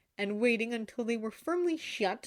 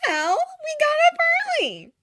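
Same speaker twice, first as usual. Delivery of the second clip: sheepish Glinda; 11 dB louder than usual. A narrator's reading. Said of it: "muttered Glinda".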